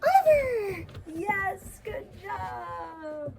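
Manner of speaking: high sing-song praise; puppy praise voice. Real, not acted. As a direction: gush over a good puppy. Calling then praising puppy.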